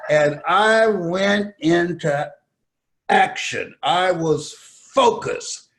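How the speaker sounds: slow, loud, drawn-out words; emphatic slow preaching. Acted. Preacher driving home his point, slow and loud. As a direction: hammer each word slowly.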